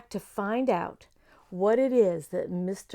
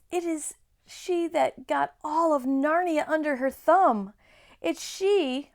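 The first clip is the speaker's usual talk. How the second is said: raised, ominous storytelling voice; dramatic fawn; 6 semitones higher, 4 dB louder than usual. A narrator's reading. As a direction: build the dread of the witch.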